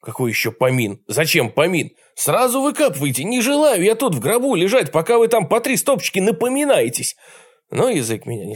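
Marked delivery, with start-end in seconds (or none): 0.0-7.1 indignant protest. Acted. Trapped narrator's inner protest.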